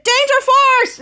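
high superhero team call; acted rallying cry. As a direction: summon the team in play. Retold hero rallies team.

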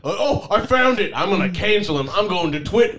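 put-on high, excited Southern accent. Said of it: "Why is he Southern?".

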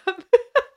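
high burst of laughter; short real laugh. Real, not acted. Laughing at movie memory.

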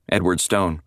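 ordinary delivery

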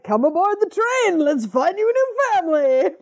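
high, coaxing lure voice; creepy stranger. Retold or imitated. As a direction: sweetly tempt a child aboard. Creepy train man voiced.

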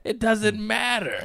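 high, drawn-out giddy squeal; retold giggle-fit exclamation, stretched out. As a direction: act helplessly slap-happy, slow and high. Acting out laughing fit with buddies.

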